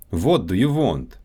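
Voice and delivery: Russian accent, "What" and "want" with a rounded "wo" vowel; Russian accent, say "What do you want" as "vot do you vont". Shown as a demonstration. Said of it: "W and V sounds".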